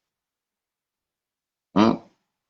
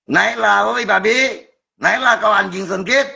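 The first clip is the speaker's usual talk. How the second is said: angry yelling; 12 semitones higher than usual. Real, not acted. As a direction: scream at the scammer to get up on stream. Yelling insults at the scammer. (teriakan marah penuh makian)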